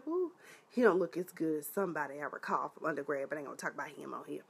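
low, fast gossip aside, almost under the breath; hushed aside. Real, not acted. Telling viewers about an old flame in a hushed aside. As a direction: drop it to a quick confiding hush.

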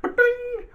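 high-pitched ba-ding; ping it out like a little bell; playful chime noise. Pretend.

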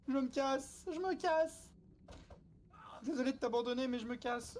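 high, hurried panic; panicked fleeing. Real, not acted. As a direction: bolt away in a fright. Fleeing the monster.

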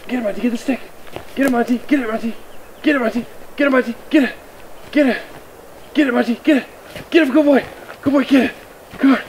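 high-pitched, quick cheering on a dog; excited urging. Real, not acted. Owner urging dog to get the stick, excited.

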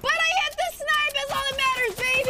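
high, loud victory yell; triumphant shout. Real, not acted. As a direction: crow over the winning shot at full voice. Celebrating a sniper hit.

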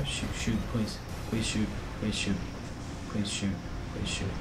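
softly begging under breath; quiet pleading. Real, not acted. Gamer begs his robot to shoot, soft pleading. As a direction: plead softly, willing it to fire.

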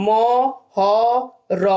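ম, হ, র each said alone with long pauses; spelled out. Shown as a demonstration. Teacher sounding out মহর্ষি piece by piece. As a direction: tick off ম হ র one sound at a time. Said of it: "আমি যদি ভেঙে লিখি".

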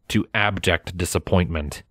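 usual speaking voice

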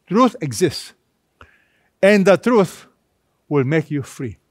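normal talking voice

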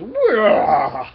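loud, high drawn-out roar; battle cry. Retold or imitated. Alien warrior attacks Nova, imitated battle cry. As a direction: let out a mock warrior's yell.

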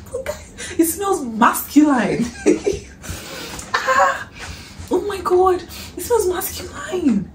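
high giggly delight; giggling. Real, not acted. Vlogger sniffing husband's perfume, giggling. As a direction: laugh through the words. Laughing while smelling perfume.

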